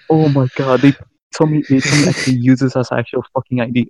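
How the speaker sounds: ordinary talk, nothing marked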